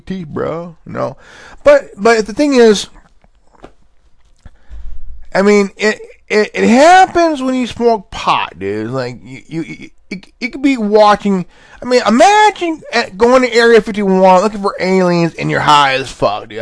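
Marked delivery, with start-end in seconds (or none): none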